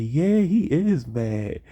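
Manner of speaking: high, drawn-out mocking agreement; teasing girls. Girls agreeing that their classmate is mad, high teasing voice. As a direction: chime in high and slow to tease. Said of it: "they was like".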